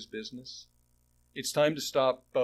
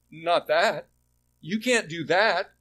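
loud, higher objection; audacious protest; 5 semitones higher, 8 dB louder, slower than usual. Retold or imitated. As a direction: push back hard, raising the voice. Habakkuk audaciously protests to God.

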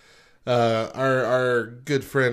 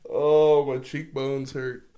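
winded from laughing; 4 semitones higher, slower than usual. Real, not acted. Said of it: "my mouth hurts".

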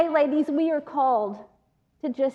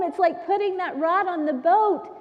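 ordinary delivery

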